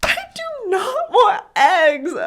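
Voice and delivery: put-on high, loud, slow wailing child shout; mock tantrum scream. Mom imitating daughter screaming about eggs. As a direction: wail it like a meltdown.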